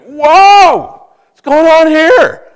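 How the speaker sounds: high, loud, startled shriek of alarm. Retold or imitated. House inspector feeling a rotten deck move, shrieking in alarm. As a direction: yelp it out as the deck gives way under you. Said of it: "And I shrieked".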